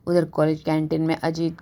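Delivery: plain reading voice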